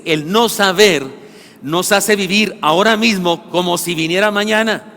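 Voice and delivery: slow, loud emphatic preaching; preaching at full force. Acted. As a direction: drive each phrase home. (predicación enfática, lenta y fuerte)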